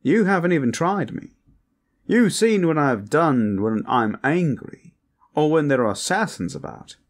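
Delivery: high, loud, showy come-on; flirtatious braggart Hakan. Acted. Hakan brags to a reluctant guest, flirtatious and over the top.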